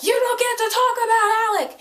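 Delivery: high, loud shout of a furious friend; hammed-up yell defending Alec; mock angry yell. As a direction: bark it out as Jace snapping at Simon. Voicing Jace angrily yelling.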